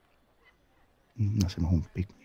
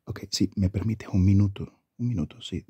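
ordinary talk, nothing marked

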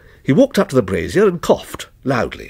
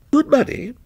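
a mildly scornful echo, pitched higher; 12 semitones higher than usual. Acted. Stranger scoffing at the watchman's pay, mildly scornful. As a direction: lightly scoff at the words. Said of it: "repeated the stranger scornfully".

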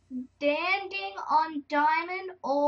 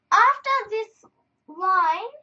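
loud reading; 6 semitones higher, 9 dB louder, much slower than usual. Real, not acted. Reading sign aloud, loud.

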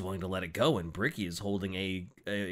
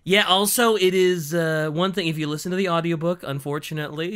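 normal talking voice

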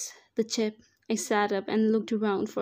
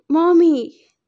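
put-on high, loud child's scream; a small boy cries out for his mother; a child screaming for his mommy; child shouting; 7 semitones higher, 13 dB louder than usual. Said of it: "Dante shouted".

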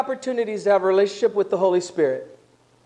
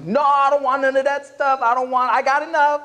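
high-pitched acted protest; mock stubborn refusal; 6 semitones higher, 4 dB louder than usual. Believer refusing the Spirit's baptism, mock high protest. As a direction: push it away, pitched up and insistent.

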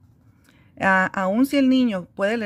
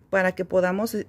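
usual speaking voice

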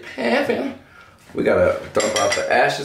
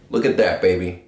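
ordinary delivery

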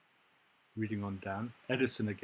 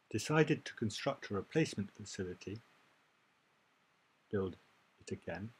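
ordinary delivery

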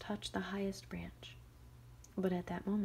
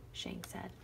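usual speaking voice

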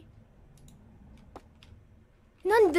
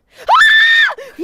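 high loud scream; 9 dB louder than usual. Real, not acted. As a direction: shriek at the jump scare. Jump scare scream.

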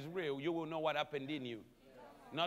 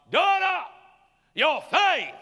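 much higher, louder preaching call; proclaiming shout; 11 semitones higher, 14 dB louder, much slower than usual. Acted. Jesus calls out to the healed woman, loud shout.

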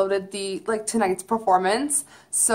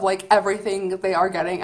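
usual speaking voice

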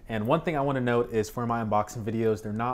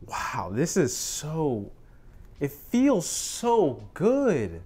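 slow, high, drawn-out delight; 5 semitones higher, much slower than usual. Real, not acted. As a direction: savor every word. Savoring the device.

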